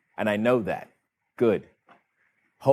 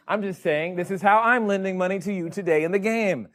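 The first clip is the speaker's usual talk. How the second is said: ordinary talk, nothing marked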